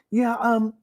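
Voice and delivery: hammed-up lady at the closet door; mock woman's voice.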